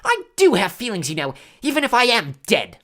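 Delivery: loud, high, wounded wail; tearful. A narrator's reading. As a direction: protest as if hurt to tears. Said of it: "choked with tears".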